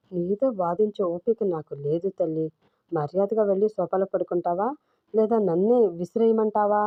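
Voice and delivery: plain reading voice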